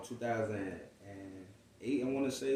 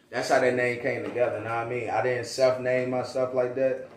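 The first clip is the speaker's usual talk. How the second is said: ordinary delivery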